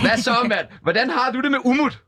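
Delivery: high-pitched presenter's greeting; radio host banter. Pretend. Host greeting the caller as new co-host, radio host banter. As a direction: greet your pretend co-host on air.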